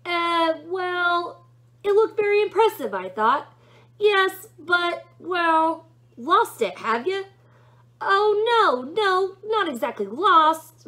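high, flustered angel stammering; high flustered angel, fast in places. A narrator's reading. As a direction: squirm like a caught-out angel. Angel flustered about sword.

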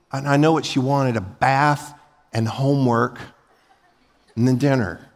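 normal talking voice

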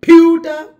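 Pewter loud and high on PEW. Shown as a demonstration. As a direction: blast the first beat of Pewter. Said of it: "It's louder".

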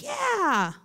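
high mock-happy voice; naive excitement. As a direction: mimic everyone cheering the extra break. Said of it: "we were all like".

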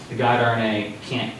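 normal talking voice

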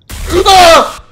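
very loud, high shriek of shock; terrified scream. Real, not acted. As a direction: scream at a sudden jump scare. Jump scare in horror game.

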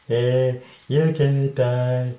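slow, loud cartoon predator threat; hammed-up menacing cat voice; aggressive cat. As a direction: draw it out like a cat about to pounce. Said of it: "have a kind of aggressive attack cat".

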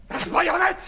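high, loud cry on the word; the bayonet flashes before him; terrified outburst; sudden fearful cry. Acted.

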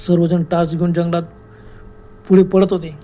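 usual speaking voice

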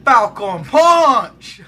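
slow drawn-out Falcon Punch battle cry; Falcon Punch yell. Pretend. Launching his rider with a drawn-out Falcon Punch battle cry. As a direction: stretch every syllable like a fighting-game hero. Shouting a game attack catchphrase.

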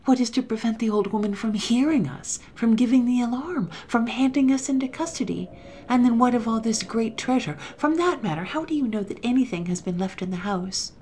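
pressing worried objections, voice climbing; frightened accomplice firing questions; excited. A narrator's reading. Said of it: "whispered the other excitedly".

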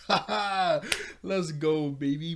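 laugh, then a loud cheer. Real, not acted. Vlogger celebrates his eightieth episode with a loud laughing cheer. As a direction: whoop it up over the milestone.

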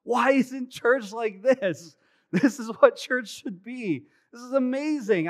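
starry-eyed preteen calling out over the concert noise; awestruck kid. Retold or imitated. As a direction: gush like a thrilled twelve-year-old. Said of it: "and I'm like".

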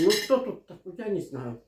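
usual speaking voice

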